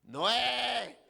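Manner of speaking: high, loud call from the back; an old lady shouting a correction; sharp high cry of the name; old woman calling out. Retold or imitated.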